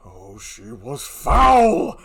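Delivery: anguished groan. Acted. Othello grieving.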